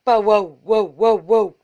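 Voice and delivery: loud bow wow barks; dog barking. A narrator's reading. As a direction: bark it out like a dog. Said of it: "said Bitzer Maloney".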